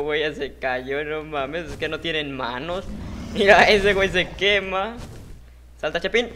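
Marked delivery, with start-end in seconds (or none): 3.3-6.3 excited shout. Real, not acted. Gamer reacting to player burning.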